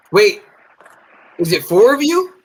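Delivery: high, startled question from the Uber driver; surprised driver. Retold or imitated. Surprised question. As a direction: driver startled to see a group. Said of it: "And she goes".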